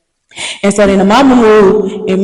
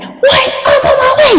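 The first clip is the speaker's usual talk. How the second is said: alarmed shout. Retold or imitated. Mom from Detroit hearing her daughter got jumped, shouting. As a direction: yell it, ready to rush over.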